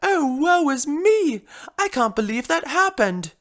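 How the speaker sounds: high, loud melodramatic lament; hammed-up despair; theatrical woe. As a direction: bemoan your fate like a drama queen. Drama queen bemoans her nightmare.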